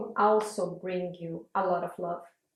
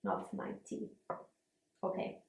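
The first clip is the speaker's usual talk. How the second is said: sipping hot tea; 9 dB quieter than usual. Real, not acted. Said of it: "let me take a sip".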